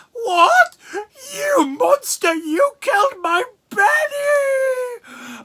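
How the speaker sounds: hammed-up wailing grief. Velma shrieking at the heroes over Benny, hammed-up sobbing. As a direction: wail it like a melodramatic widow.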